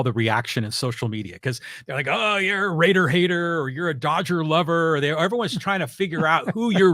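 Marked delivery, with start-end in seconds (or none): 2.1-5.0 higher, put-on heckler voice; teasing imitation of indignant fans; mock accusation. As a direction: imitate an online accuser in a raised voice. Imitates social media accusers.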